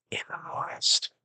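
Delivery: soft whispered aside; hushed whisper. Real, not acted. Answering where mushrooms grow, whispered. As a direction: whisper it like a quiet hint.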